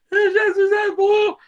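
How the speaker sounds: alto, lento e choroso, com a voz embargada. Retold or imitated. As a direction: fala chorando, a voz quebrando de emoção. Chorando quando o filho entra. (falando chorando quando o filho abre a porta)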